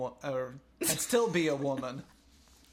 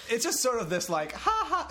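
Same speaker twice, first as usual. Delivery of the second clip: high laughing voice; 7 semitones higher, 4 dB louder than usual. Real, not acted. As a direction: laugh through the words. Laughing while explaining.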